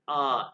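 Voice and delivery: long open "आ" for A; German letter pronunciation. Shown as a demonstration. Teacher naming the first German letter, careful demonstration. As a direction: hold "आ" as a drawn-out ah. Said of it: "इसको कहते हैं".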